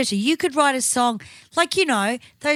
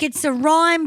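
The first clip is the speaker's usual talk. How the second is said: normal talking voice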